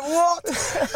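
high-pitched, disbelieving yelp; comic shocked outburst; incredulous. Retold or imitated. Retells his disbelief at the diagnosis.

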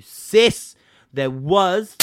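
insisting to the operator that the visit never happened; emphatic insistence. Retold or imitated. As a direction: raise the voice to make the point stick.

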